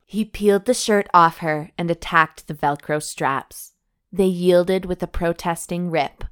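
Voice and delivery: steady read-aloud storytelling voice; romance story narration. A narrator's reading. Host reading a steamy novel to her cohost, narration voice. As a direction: read the love scene out like an audiobook.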